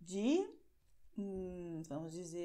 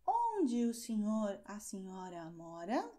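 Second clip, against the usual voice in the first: teacher modelling a formal question; formal model question. Shown as a demonstration. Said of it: "se a situação for formal".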